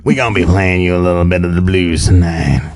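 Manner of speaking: hammed-up radio blues man intro; playful late-night blues host; blues singer voice. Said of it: "my gravelly, nasally voice".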